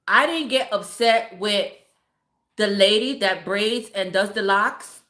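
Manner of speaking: usual speaking voice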